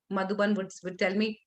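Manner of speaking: usual speaking voice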